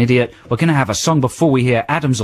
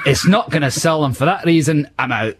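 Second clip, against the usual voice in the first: higher-pitched Scottish investor impression; Duncan Ballantyne; 3 semitones higher than usual. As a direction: turn down a pitch in a put-on Scottish accent. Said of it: "I love your impression of that guy".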